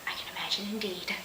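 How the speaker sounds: barely voiced, dry aside; whispered aside. Real, not acted. Narrator's private comment on the sad scene, whispered. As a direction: whisper it to yourself. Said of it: "whispered aside".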